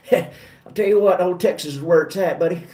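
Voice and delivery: folksy Texan president impression; George W. Bush. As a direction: do a presidential Texas twang. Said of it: "or my George W. Bush impersonation".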